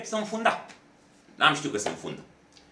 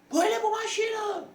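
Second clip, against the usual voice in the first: cop yelling. Retold or imitated. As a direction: bark it like an aggressive cop making an arrest. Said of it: "zbiera la mine".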